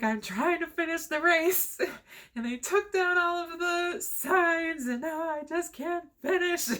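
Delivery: high, slow tearful plea; half-crying through the words. Retold or imitated. Telling the race director the signs are gone, tearful and high. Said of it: "sees me over here crying".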